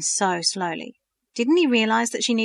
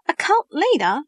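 surprised echo; 9 semitones higher, 6 dB louder than usual. A narrator's reading. Verity surprised at his words.

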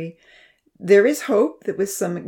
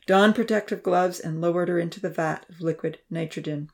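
usual speaking voice

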